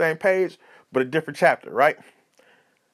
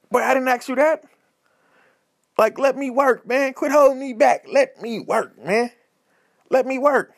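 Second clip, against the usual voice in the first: raised-pitch pleading complaint; mock-indignant appeal to be left alone; high, worked-up acted griping; exasperated protest; 6 semitones higher than usual. Acted protest to the blocking homeboy.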